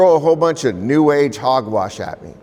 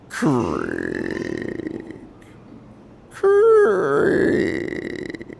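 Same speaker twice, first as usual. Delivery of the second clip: slow, drawn-out creaking sounds; tree creaking; 4 dB quieter, much slower than usual. Retold or imitated. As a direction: creak like an old swaying tree. Imitating the tree's creaking.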